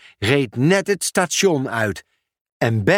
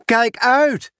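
higher warning shout in passing; warning call; 9 semitones higher than usual. Acted. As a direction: warn her while running past. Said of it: "waarschuwde Pieter Post haar". (hoge waarschuwende roep)

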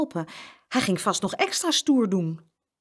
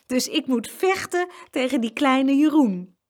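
put-on higher, louder boy's incredulous voice; amused disbelief; 7 semitones higher, 5 dB louder than usual. A narrator's reading. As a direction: scoff at the very idea. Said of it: "Hij begon te lachen".

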